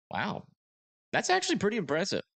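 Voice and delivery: genuinely impressed, voice jumps up; thrilled at strong shear readings; excited. Real, not acted. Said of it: "reacting to radar data".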